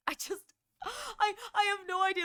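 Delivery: high, halting words through a crying laugh; real laughing despair; laughing helplessly. Real, not acted. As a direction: give up laughing, voice cracking.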